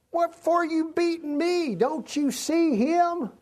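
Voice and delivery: Balaam's donkey complaining, high and slow; talking donkey voice. Acted. Donkey voiced in Bible story.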